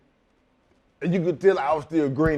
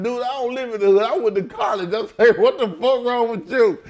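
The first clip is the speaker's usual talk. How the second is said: higher and louder, an indignant retold outburst; heated retort; 8 semitones higher, 5 dB louder than usual. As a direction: push back hard, half-laughing disbelief. Retold heated argument with promoter.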